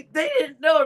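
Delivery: ordinary talk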